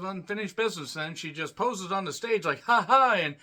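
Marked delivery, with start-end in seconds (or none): 2.6-3.1 loud, fake taunting laugh; a loud showy "ha ha" taunt; mock laugh. Mocking a wrestler's pose on stage.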